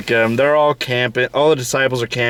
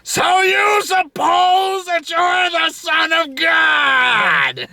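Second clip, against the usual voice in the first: high, slow, squawking comedian impression; Gilbert Gottfried; much slower than usual. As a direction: stretch it out in a strained screech. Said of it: "Gilbert Gottfried did a Jesus movie".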